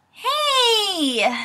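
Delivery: high, drawn-out hey; called-out greeting. Acted. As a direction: call out a hello on arriving. Character arrives late, calls out.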